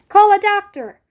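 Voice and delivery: high, loud urgent shout; a father calling for help; loud panicked call. A narrator's reading. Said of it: "shouted Mister Beauregard".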